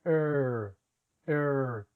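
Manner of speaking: fused er sound, no trill; English R. Shown as a demonstration. Teacher drilling the English R. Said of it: "Practiquemos la R".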